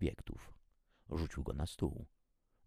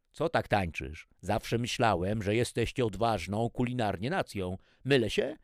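loud, raised challenge; needling chef; 5 semitones higher, 7 dB louder than usual. A narrator's reading. Chef pressing the nervous trainee to taste, loudly needling. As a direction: goad him, rising at the end.

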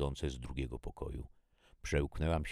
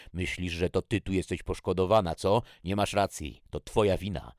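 raised, loud accusing voice; angry; 5 semitones higher, 9 dB louder than usual. A narrator's reading. Jackie blames her younger sister, angry. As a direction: lash out with scorn. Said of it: "kiedy się gniewała".

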